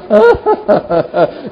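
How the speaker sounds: acted-out incredulous laugh, repeated ha-ha bursts; Sara's mock laugh. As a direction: imitate Sara laughing at the promise. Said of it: "é igual que a, que Sara fez". (risada incrédula encenada)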